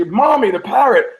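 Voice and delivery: put-on high-pitched little girl calling mommy; put-on high child's voice; young daughter. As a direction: imitate a child piping up. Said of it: "with her daughter this time".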